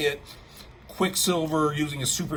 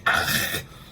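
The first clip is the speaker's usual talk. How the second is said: imitated choke from a hit to the neck; raspy, voiceless mock gag; choking noise. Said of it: "hitting him in the neck".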